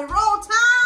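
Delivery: shouted cheer. Real, not acted. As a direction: yell it like a fan.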